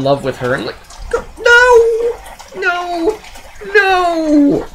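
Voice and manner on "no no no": long, very high comic wail of refusal; exasperated high-pitched no. Acted. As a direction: draw out each no way up high. Horrified reaction to the film's plot.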